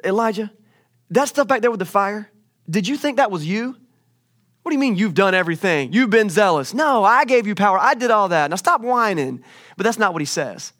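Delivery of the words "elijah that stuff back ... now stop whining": raised, loud mock scolding; acted indignant rebuke; scolding God. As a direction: tell off a whiner, building in force. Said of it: "God could have come to Elijah and saying".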